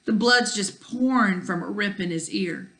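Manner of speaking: ordinary delivery, nothing marked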